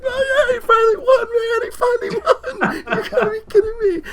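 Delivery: high, laughing, tearful cry of joy; overjoyed near tears. Retold or imitated. Fan hugging his friend after the win, tearful laughing squeal. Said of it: "and I was like".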